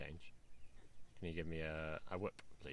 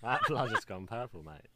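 higher, louder, laughing through it; 4 semitones higher, 6 dB louder than usual. Real, not acted. Learner joking about a wrong slang guess, laughing. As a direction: crack up at your own joke.

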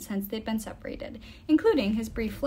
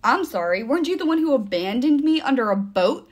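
loud sarcastic accusation; heated, raised-voice rebuke; accusing; 3 semitones higher, 7 dB louder than usual. A narrator's reading. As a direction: throw the blame back hard. Nico accuses Will in a quarrel.

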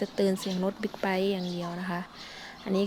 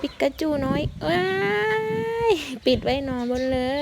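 high delighted coo, a bit louder; excited cooing; 7 semitones higher, 3 dB louder than usual. Real, not acted. Mahout delighted by the baby elephant climbing, excited. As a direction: squeal fondly at the little calf.

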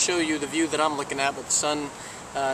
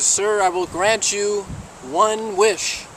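slow, higher-pitched genie proclamation; grand genie pronouncement; 5 semitones higher, 4 dB louder, much slower than usual. Acted. Genie to the man who opened the bottle, grand and slow. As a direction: proclaim it slowly like a genie granting a favour.

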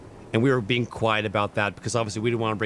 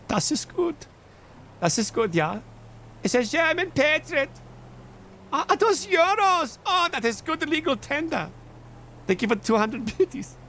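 high-pitched mock German voice; German accent. Streamer thanking a German patriot for a donation, playful German accent.

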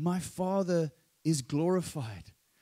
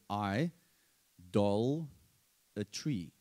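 I, dol, a tree spoken as separate words with pauses; broken into syllables; 5 semitones lower, slower than usual. Shown as a demonstration. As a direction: split it slowly into I, dol, a tree. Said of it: "you break it up".

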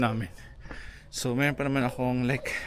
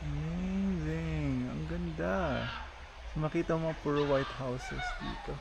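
soft, long drawn-out wonder, higher; hushed awe; 4 semitones higher, 7 dB quieter, slower than usual. Real, not acted. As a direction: marvel slowly under your breath. Awed at the view from above.

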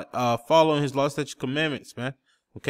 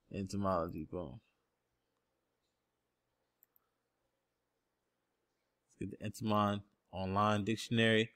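soft, low half-to-self searching voice; absent-minded and quiet, pitched well down; talking to himself; 6 semitones lower, 9 dB quieter than usual. Real, not acted. Preacher to himself hunting for a dictionary, low and soft.

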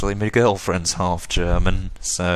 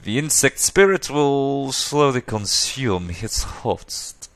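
put-on very slow, drawn-out German accent, pitched up; slow German-accented figure; 5 semitones higher, slower than usual. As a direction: relish each word in a slow German accent.

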